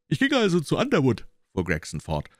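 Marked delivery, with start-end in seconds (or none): 0.1-1.3 much higher and louder, show-off; boastful detective. A narrator's reading. Gregson telling Holmes how he cracked it. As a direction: brag about your cleverness. Gregson continues his account.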